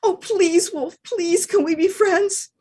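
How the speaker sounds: high, begging dog voice; high puppy pleading; pleading little dog. Acted. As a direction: beg sweetly for friendship. Dog begs wolf for friendship.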